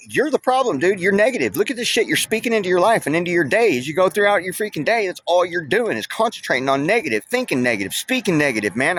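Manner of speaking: re-enacting the moment he told him off; retold heated confrontation. Retold confronting negative coworker.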